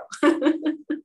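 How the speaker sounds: quick high laugh at her own joke; short high real laugh. Real, not acted. Laughing after giving her name.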